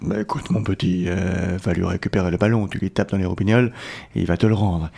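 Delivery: a hint of a blunt dad giving advice; dad's voice. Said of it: "fais-nous la voix du petit Kevin". (voix de papa un peu grave)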